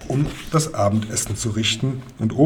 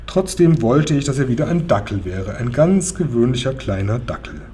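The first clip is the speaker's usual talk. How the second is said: usual speaking voice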